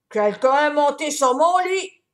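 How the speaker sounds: papa bear booming from the bedroom; big voice. A narrator's reading. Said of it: "dit Papa Ours d'une grosse voix".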